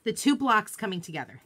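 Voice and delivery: normal talking voice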